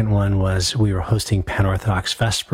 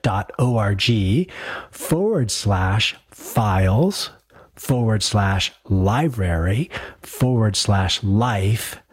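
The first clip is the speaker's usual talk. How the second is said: slowly spelling out a web address; each piece read slowly for writing down; slow dictation; slower than usual. Shown as a demonstration. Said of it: "Saint Mary of Egypt".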